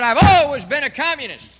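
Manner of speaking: Castro's loud boast. Retold or imitated. Castro telling the world he's always been communist, loud and defiant. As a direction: shout it out like a proud confession. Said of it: "and he said".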